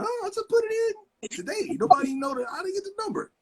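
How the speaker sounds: stunned that the new phone is ringing; high-pitched baffled disbelief; surprised disbelief. Retold or imitated. Phone rings in a brand-new car.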